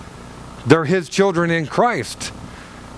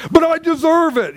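mock whine; 13 semitones higher, 3 dB louder than usual. Mock self-pitying protest.

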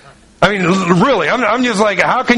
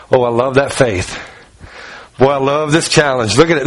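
low, pleased musing as Jesus; delighted Jesus; 6 semitones lower than usual. Retold or imitated. Preacher voicing a delighted Jesus, low and pleased. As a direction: savour the challenge, voiced lower.

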